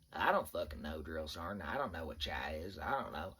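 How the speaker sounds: a hint of a lost private owning up; clueless recruit. Recruit quizzed by drill sergeant, low clueless voice.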